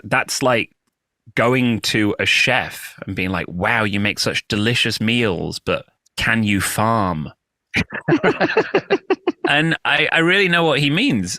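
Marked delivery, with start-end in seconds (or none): 0.0-7.3 slow deadpan quote. Retold or imitated. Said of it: "he goes". 7.7-9.4 bright burst of laughter; high laugh. Real, not acted. Laughs at the joke.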